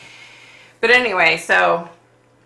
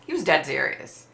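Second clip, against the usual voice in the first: ordinary talk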